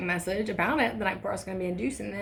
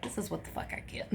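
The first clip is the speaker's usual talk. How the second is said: muttered; 11 dB quieter than usual. Retold or imitated. Mutters to herself in labor.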